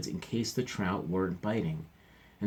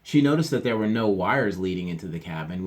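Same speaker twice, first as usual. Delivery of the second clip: ordinary delivery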